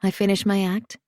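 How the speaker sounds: ordinary delivery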